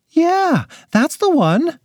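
high, excited mom; delighted. Acted. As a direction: gush with delight. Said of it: "said her delighted mother".

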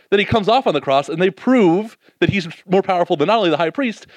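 usual speaking voice

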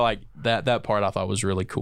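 normal talking voice

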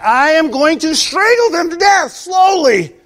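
high, loud, slow mock fury; mock murderous shout. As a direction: threaten slowly like a furious person. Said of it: "Those things that you think".

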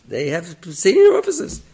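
a hint of a loud officer. Officer's voice, raised.

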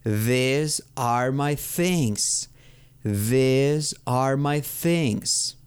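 very slow, THESE held on a long ee; exaggerated long-vowel model. Shown as a demonstration. Teacher giving learners another plural example, slow careful diction. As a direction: stretch "These" and space every word. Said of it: "lo digo como con doble I en español".

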